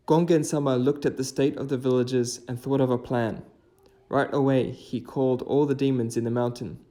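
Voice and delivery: story narration. A narrator's reading. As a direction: tell the old legend to viewers. Narrates a folk tale.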